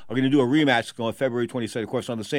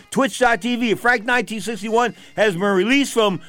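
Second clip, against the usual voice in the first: hyped announcer; 8 semitones higher, slower than usual. Real, not acted. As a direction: call out the show name like a promo. Hyped sign-off.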